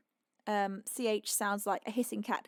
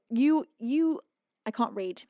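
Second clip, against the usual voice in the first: ordinary talk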